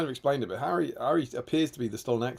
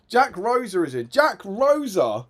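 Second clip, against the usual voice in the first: higher, louder, slow on Jack Rosa; excited welcome; 8 semitones higher, 8 dB louder than usual. Real, not acted. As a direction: announce the name with delight. Excited at arrival.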